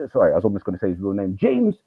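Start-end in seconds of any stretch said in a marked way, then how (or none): 1.4-1.8 put-on much higher, strained voice of the teen; upset teen. Teen defending his murdered friend to the teacher. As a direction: speak up for a lost friend.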